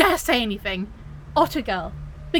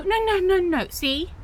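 ordinary talk, nothing marked

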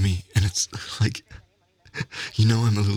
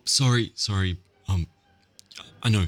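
usual speaking voice

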